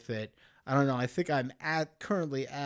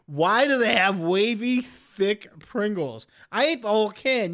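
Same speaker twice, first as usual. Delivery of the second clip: indignant rant; 7 semitones higher, 9 dB louder than usual. Real, not acted. Ranting about thick Pringles.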